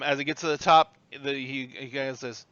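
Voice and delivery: ordinary delivery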